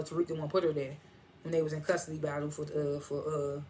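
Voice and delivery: ordinary talk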